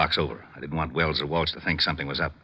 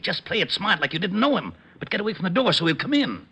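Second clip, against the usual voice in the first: urgent warning; 12 semitones higher than usual. Acted. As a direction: hurry him away from the door. Urgent instructions before suspect enters.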